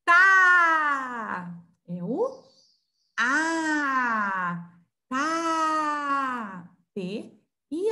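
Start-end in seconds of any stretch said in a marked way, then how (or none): 0.0-1.5 "Tá" loud and high, with a long held A; teacher's emphatic, sustained "Tá"; syllable demo. Shown as a demonstration. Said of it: "Falta uma vogal". 3.2-6.7 "A" and "Tá" each held long; sustained teaching vowel in "A. Tá"; vowel demo. Shown as a demonstration. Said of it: "Falta uma vogal".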